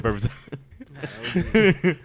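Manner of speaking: very slow, high, laughing through the words; cracking up mid-phrase. Real, not acted. Laughing repeat.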